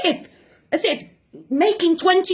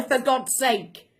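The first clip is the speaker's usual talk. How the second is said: ordinary talk, nothing marked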